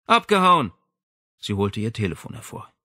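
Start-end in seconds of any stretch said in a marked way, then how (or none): 0.0-0.7 sharp loud cry of alarm; shouted. Acted. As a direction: shout it out, furious they fled. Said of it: "rief sie".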